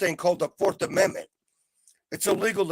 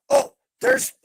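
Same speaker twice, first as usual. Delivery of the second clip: excited; 6 dB louder, slower than usual. Real, not acted. His mom turns up on stream.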